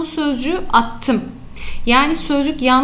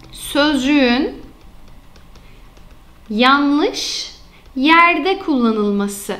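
slow dictation; much slower than usual. Shown as a demonstration. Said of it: "teacher dictates the error type".

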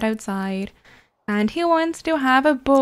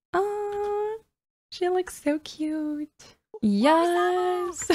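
high, soft, slow cooing; 7 semitones higher, 5 dB quieter, much slower than usual. Real, not acted. Player to her pregnant Sim, soft high cooing. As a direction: melt over something adorable.

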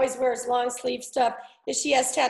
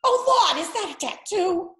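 high, horrified Boston mother; horrified Boston mom, higher; Boston accent; 7 semitones higher than usual. Retold or imitated. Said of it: "Just horrified".

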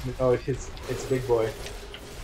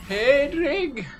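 high mock cry of a name; hammed-up call to a loved one; mimicking dying woman; 12 semitones higher than usual. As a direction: call out high, imitating her. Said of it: "me and Jake saying Hadrig".

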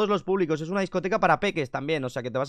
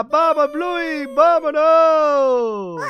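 high, long drawn-out call; excited holler, slowly drawn out; rallying call; 8 semitones higher, 6 dB louder, much slower than usual. Pretend. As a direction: stretch it out as a rousing send-off. Rallying Bluey to leave for disco.

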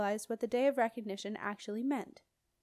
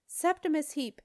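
loud, high indignant yell; 5 semitones higher, 7 dB louder than usual. A narrator's reading. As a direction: yell it as an outraged refusal. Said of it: "Jenna yelled".

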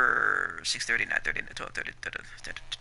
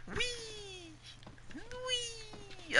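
high soft squeals of glee; playful whee; 15 dB quieter than usual. Pretend. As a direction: squeal like bouncing fun. Jumping playfully.

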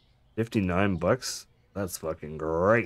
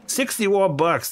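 drawn-out, much higher cry of amazement; amazed; 10 semitones higher, 3 dB louder, much slower than usual. Real, not acted. Cashier marvelling at a sixty-one buck shop, slow high amazement. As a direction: stretch it out in disbelief at the total.

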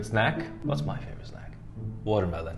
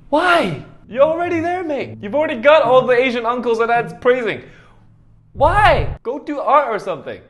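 high, loud disbelieving plea; exasperated rant; 7 semitones higher, 3 dB louder than usual. Real, not acted. Host scolding an overachieving character, exasperated and loud. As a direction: cry out the why, high and exasperated.

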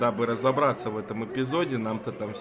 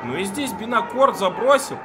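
excited; 7 semitones higher, 4 dB louder than usual. Real, not acted. Reacts to a surprise goal.

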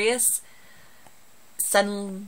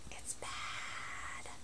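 whisper; 21 dB quieter than usual. Real, not acted. Aside about her accent.

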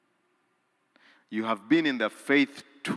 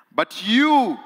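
declaiming; 12 semitones higher, 13 dB louder than usual. Acted. Scripture declaimed.

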